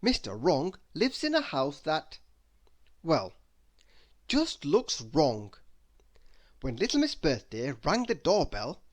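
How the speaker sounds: ordinary delivery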